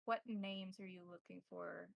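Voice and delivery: usual speaking voice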